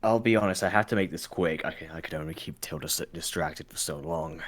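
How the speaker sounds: low, hushed aside; confiding. Acted. Mercenary who has to make this quick, quietly confiding. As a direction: drop the voice and hurry it along.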